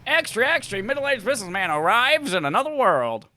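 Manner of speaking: hammed-up newspaper seller; newsboy headline call. Shown as a demonstration. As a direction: cry it out as a newsboy hawking papers. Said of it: "Not like a headline".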